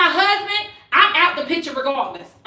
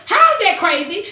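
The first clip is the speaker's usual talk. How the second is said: usual speaking voice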